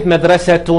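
مدرسة said madrasatun, with T and nunation; ending pronounced -tun. Shown as a demonstration. Teacher introducing ta marbuta, مدرسة ending in -tun. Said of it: "دي بتاء مربوطة".

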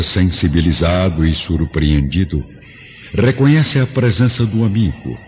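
normal talking voice

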